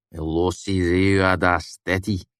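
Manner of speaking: usual speaking voice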